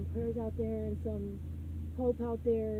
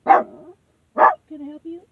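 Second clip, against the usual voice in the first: drawn out, much louder, rising; loud and slow; 7 semitones higher, 15 dB louder, much slower than usual. Real, not acted. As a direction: call it out slowly and loudly. Stranger at the door, repeated.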